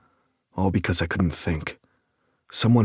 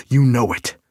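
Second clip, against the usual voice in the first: "You know it" punched louder and higher, "You" hit hardest; stress on last words; 5 semitones higher, 7 dB louder than usual. A narrator's reading. Nick pressing Lake with "You know it", loud and high. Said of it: "I hadn't meant to emphasize the last words".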